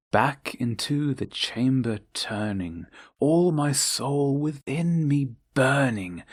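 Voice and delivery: usual speaking voice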